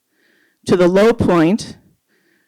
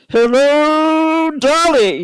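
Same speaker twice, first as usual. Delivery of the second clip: bellowing; 8 semitones higher, much slower than usual. Acted. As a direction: bellow it like a showstopper. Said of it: "you bellow".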